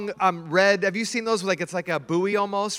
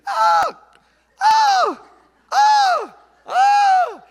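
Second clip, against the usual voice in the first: loud high cries, one per spin; imitated screams; 7 dB louder, much slower than usual. Preacher reenacts the lady's loud panicked cries. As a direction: yelp out each time she spins under. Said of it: "it'd be like".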